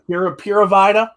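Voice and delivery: "Pura Vida" tried as PEER-uh VYE-duh, unsure; unsure try at "Pura Vida" with an English long i; attempted Spanish. Shown as a demonstration. Host asks viewers if he says it right: "Pura Vida" tried as PEER-uh VYE-duh. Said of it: "am I saying it right?".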